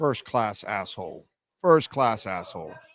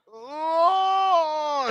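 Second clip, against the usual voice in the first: a sudden, much higher cry of pain, played up; mock cry of pain. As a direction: let out a hammed-up pained yelp. Cracking after the paddling.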